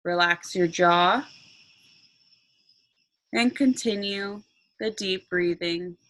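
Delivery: slow meditation voice with long pauses; guided meditation. Acted. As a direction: guide them calmly into relaxation.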